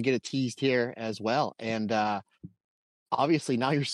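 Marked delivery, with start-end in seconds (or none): none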